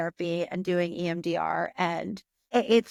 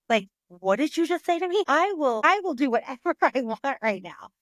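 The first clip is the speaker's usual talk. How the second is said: indignant retort; 6 semitones higher than usual. Retold or imitated. Indignant retort to husband in story.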